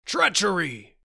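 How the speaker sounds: high, loud exclamation; outraged cry. A narrator's reading. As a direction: cry it out as an accusation. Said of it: "he cried".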